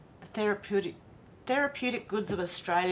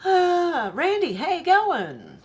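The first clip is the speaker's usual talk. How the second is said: loud, high, delighted hello to a regular; excited greeting; 10 semitones higher, 9 dB louder, slower than usual. Real, not acted. Streamer loudly greeting Randy in the chat, excited. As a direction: burst out with a big happy welcome.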